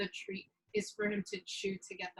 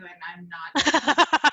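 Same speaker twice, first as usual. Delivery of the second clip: laughing; 3 semitones lower, 12 dB louder, much slower than usual. Real, not acted. Laughing refusal of roles.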